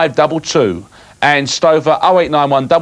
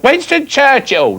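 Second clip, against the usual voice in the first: put-on higher, slow doddery old lady; old granny voice; 9 semitones higher, slower than usual. Pretend granny answering at the polling booth. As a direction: play a confused old granny. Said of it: "you're a bit of an old granny".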